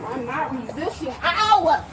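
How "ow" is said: pained shout. Real, not acted. Arm pulled during arrest.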